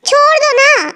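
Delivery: high, begging plea to be let go; pleading girl. Acted. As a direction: whine it out, helpless and scared. Captive girl begs to be released.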